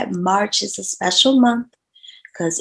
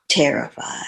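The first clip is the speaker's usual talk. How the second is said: reflective father; 4 semitones lower, 5 dB quieter than usual. Retold or imitated. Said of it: "he looked super reflective".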